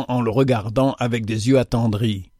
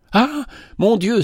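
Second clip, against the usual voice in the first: high, amazed cry of "Mon Dieu"; awed cry; 9 semitones higher than usual. Acted. Widow seeing the child transfigured, awed cry. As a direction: cry out in wonder. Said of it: "s'écria madame Chamusot".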